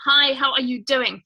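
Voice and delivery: ordinary delivery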